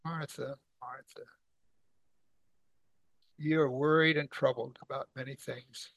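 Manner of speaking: very soft, sorrowful gentle appeal; heartfelt tenderness. Acted. Jesus gently answering worried Martha, soft and heartfelt. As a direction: say the name hushed, then soothe softly. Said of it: "really heartfelt".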